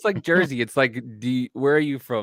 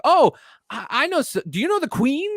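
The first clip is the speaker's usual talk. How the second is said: high-pitched mock naive traveller; excited tourist; 11 semitones higher, 3 dB louder than usual. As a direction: imitate an eager, clueless tourist, voice pitched way up. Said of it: "they're like".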